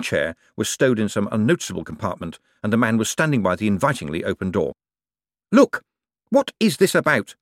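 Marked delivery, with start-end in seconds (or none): 5.5-7.3 high-pitched, agitated demand; urgent, indignant questioning; breathless demand. A narrator's reading. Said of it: "Moist demanded, panting for breath".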